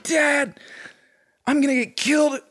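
very high, whiny mock wail; hammed-up tearful plea in a squeaky pitch; mock baby crying. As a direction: imitate yourself bawling like a little baby. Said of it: "I started crying like a little baby".

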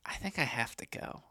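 resigned whisper to himself; unvoiced resigned whisper. Real, not acted. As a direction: breathe it out, defeated.

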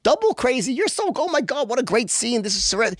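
high, gushing mock female editor. Editor cheering on the author's crazy writing, high gushing. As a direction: squeal the praise like a delighted editor. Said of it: "she's like".

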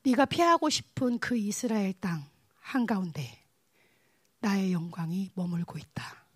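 slow, hushed divine voice; the Lord's solemn word. Retold or imitated. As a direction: speak slowly and low as God revealing himself. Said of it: "주님이 저한테 이렇게 말씀하셨어요".

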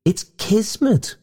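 very high, ghostly wail with relish; zestful wail. A narrator's reading. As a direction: wail it high, enjoying the drama. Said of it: "wailed the ghost zestfully".

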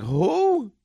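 high, incredulous single word; baffled who. Retold or imitated. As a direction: squeak out a puzzled who. Retold reaction to unknown player.

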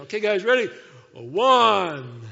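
very loud, drawn-out heave count; hearty count-off. Acted. Sailors ready to heave Jonah overboard, loud count-off. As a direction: shout the count as if swinging someone.

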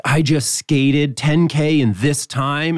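bragging. Retold or imitated. Skier back from the trail showing off to Melissa, slow boast. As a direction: show off proudly, stretching the words out. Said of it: "I brag to Melissa".

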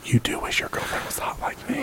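breathy sultry whisper. Pretend. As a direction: tease them in a whisper. Flirty tease to the hosts.

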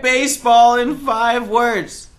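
radio announcer. Acted. Playing radio host to caller.

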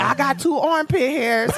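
put-on slow, proud young boy; boasting boy with a laugh; little boy voice. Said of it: "That was my little boy's voice".

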